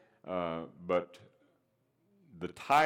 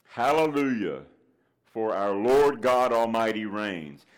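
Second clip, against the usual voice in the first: proclaimed refrain; 2 semitones higher, 6 dB louder than usual. Acted. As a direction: ring it out to the whole church.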